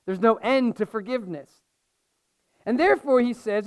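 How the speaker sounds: normal talking voice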